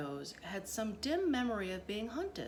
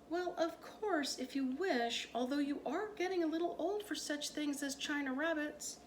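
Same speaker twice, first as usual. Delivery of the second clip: indulgent mother; 8 semitones higher than usual. A narrator's reading. Mother tells her daughter she's too old for china rabbits, sweetly condescending. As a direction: humour the child from above, sweetly.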